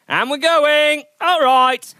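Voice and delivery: fired-up coach shout. Real, not acted. Coach pumping up the ride.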